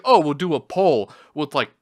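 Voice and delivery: sarcastic high imitation of the site staff; mocking the mods. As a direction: parrot their poll questions with a sneer. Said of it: "So, like".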